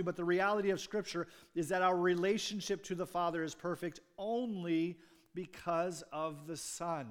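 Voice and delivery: loud, driving sermon voice, pushing each "finally"; preaching at full force. Acted. Pastor preaching to the church, building to a loud sermon voice. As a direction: preach it hard to the congregation.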